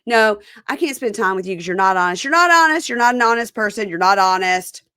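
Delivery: loud, insistent retold quarrel; heated accusation. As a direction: act out hammering the same accusation. Acting out his repeated accusation.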